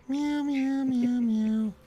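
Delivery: high-pitched cat meows; nervous cat voice. Pretend. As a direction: meow like a little cat, way up high. Meowing after cat talk.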